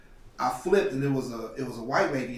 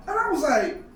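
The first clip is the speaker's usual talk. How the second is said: angry; 12 semitones higher, 5 dB louder than usual. Retold or imitated. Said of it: "I'm so mad".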